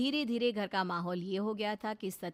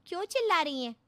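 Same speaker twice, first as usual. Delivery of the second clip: क्यों चिल्ला रही हैं asked higher and louder, in alarm; sister asking; 9 semitones higher, 6 dB louder, faster than usual. Acted. Sister questions the shouting.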